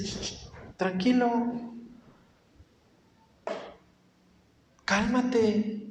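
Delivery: soft, hushed shushing to calm someone; soft hushing. Retold or imitated. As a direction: settle a fretting child gently. God hushing the anxious believer. (susurro tranquilizador)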